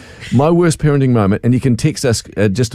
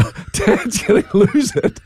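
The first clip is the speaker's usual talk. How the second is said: put-on scared kid; 11 semitones higher, slower than usual. Child's fearful thought.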